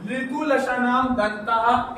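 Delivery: slowly intoned sutra, every syllable spaced out; deliberate chant-like recitation. Shown as a demonstration. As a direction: recite the rule slowly for the class to copy.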